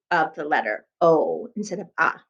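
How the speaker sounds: ordinary talk, nothing marked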